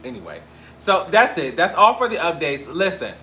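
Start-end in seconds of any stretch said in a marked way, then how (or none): none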